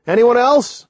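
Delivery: high-pitched yelled question. A narrator's reading. Person on the boat asks for others, yelling. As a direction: call the question over to the shore. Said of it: "The person on the boat yelled".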